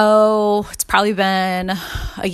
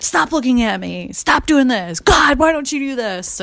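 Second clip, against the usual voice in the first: angry yelling; 7 semitones higher, 3 dB louder than usual. Retold or imitated. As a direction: snap at everyone to get away. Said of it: "And just yelling".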